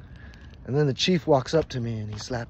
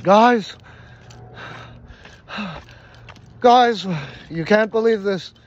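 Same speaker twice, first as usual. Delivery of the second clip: high, loud call between puffs of breath; breathless excited announcement; 6 semitones higher, 8 dB louder, slower than usual. Retold or imitated. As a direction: call the group, winded and amazed. Runs back to tribe with news.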